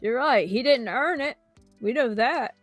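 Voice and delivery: ordinary talk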